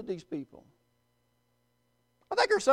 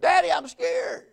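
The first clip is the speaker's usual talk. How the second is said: put-on high-pitched frightened child plea; acted little-boy fear, pitched up; scared little boy; 8 semitones higher than usual. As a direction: play a frightened kid running to Daddy. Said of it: "he's just scared and crying".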